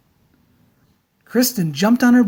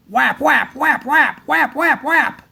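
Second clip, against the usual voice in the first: flapping sounds; 6 semitones higher, slower than usual. A narrator's reading. Flipper slapping father's face.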